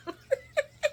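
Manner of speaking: high-pitched real laugh; short burst of high laughter. Real, not acted. Laughing at Drew's retold line, high. Own laugh after retelling.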